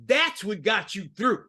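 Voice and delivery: ordinary delivery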